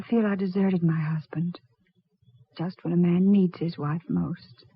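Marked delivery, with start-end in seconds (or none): none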